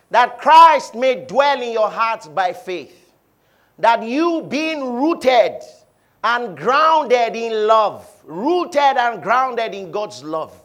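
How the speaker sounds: slow, loud reading; solemn preached reading; scripture reading. Acted. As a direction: weigh each word of the verse. Reads scripture slowly.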